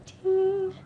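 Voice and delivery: high, ringing bell sound; ping it out like a tiny bell; imitated bell. Miming a little Indian bell before singing, high ding. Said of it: "But I would play that".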